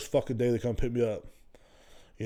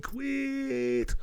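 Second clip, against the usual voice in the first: squeaky high quit; 14 semitones higher, 4 dB quieter than usual. Pretend. Teasing the absent producer in a squeaky high voice.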